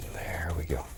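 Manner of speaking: soft whispered aside. Real, not acted. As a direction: breathe it out quietly.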